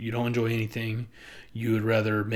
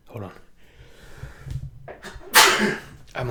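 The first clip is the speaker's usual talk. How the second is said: ordinary talk, nothing marked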